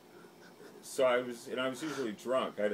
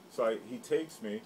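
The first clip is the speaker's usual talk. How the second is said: normal talking voice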